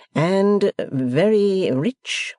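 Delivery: host quizzing his foreign guest, very slow; pompous Podsnap. Acted. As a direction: drag it out as if to a child.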